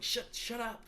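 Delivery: high-pitched, stammered protest; mock indignant retort. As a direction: hush the killjoys in a high voice. Retold retort to the doubters.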